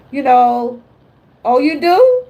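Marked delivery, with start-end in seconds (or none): 1.4-2.2 mock doubtful reply. Mocking someone's doubting reply.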